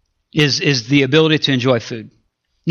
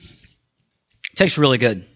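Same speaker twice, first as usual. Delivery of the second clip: mouth full; 6 semitones lower, 6 dB quieter, slower than usual. Real, not acted. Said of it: "talk with my mouth full".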